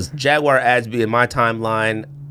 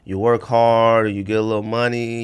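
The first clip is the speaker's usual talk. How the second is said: usual speaking voice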